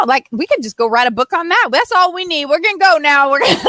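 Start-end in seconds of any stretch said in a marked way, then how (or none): 2.4-3.7 laughing through the words. Real, not acted. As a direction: giggle as you say it. Laughing joke about leaving.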